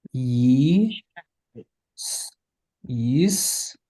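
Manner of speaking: Yisrael broken into slow separate pieces, yis-ra-el; sounded out syllable by syllable. Shown as a demonstration. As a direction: draw out each syllable of Yisrael for learners. Teacher sounds out word by syllables.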